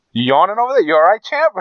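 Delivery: high teasing voice; mock concern for a yawner. Real, not acted. Teasing friend who yawns.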